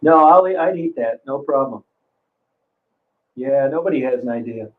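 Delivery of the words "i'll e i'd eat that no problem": ordinary talk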